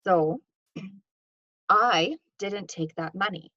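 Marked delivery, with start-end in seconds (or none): 1.7-3.5 I punched, rest of the line even; stress on I. Shown as a demonstration. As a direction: lean hard on "I", as if someone else did it. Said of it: "This implies that potentially somebody else did".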